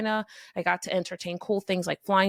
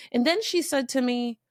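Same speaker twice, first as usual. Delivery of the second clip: ordinary talk, nothing marked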